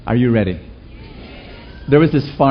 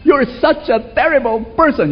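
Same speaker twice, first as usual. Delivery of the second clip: high, scolding tone; shaming voice; 10 semitones higher than usual. Retold or imitated. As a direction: shame them, pitched high.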